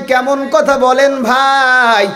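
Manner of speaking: high, slow chanted call; sing-song boatman's hail. Acted. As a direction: call back high, dragging out the last word. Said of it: "ডাক দিয়া কয়".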